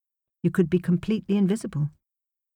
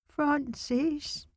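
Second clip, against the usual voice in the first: put-on groggy child voice; 10 semitones higher, 7 dB quieter than usual. A narrator's reading. Sleepy child calls her.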